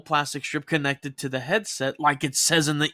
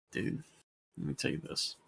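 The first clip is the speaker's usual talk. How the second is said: hushed buildup; 3 semitones lower, 12 dB quieter than usual. Real, not acted. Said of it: "building up to story".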